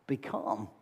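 Jesus speaks to the wind and waves, hushed and low; hushed command. Retold or imitated. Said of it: "Jesus says to the wind and the waves".

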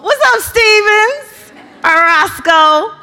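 loud, slow shout-out across the room; calling out. Real, not acted. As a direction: call their names out to the crowd. Shouting out to friends in crowd.

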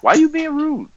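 very high-pitched mock-hurt voice. Co-host answering a snappy friend, mock-hurt high voice. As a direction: plead in a little high voice.

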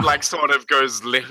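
usual speaking voice

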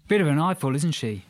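loud, high Australian twang; cheeky Aussie bloke, loud; Australian accent. Acted. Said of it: "A loud Australian twang".

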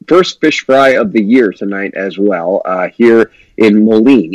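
ordinary delivery

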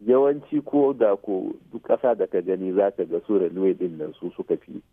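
ordinary talk, nothing marked

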